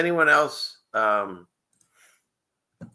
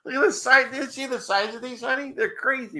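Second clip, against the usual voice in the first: high, wide-eyed amazement; amazed; 14 semitones higher than usual. Real, not acted. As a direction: marvel at the size. Amazed at the crabs.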